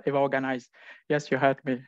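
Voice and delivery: ordinary talk, nothing marked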